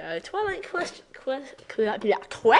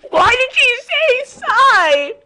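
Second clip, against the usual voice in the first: high, loud, slow laughing outburst; laughing yell; 10 dB louder, slower than usual. Real, not acted. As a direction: laugh it out in disbelief. Laughs at the line.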